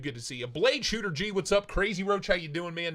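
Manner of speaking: normal talking voice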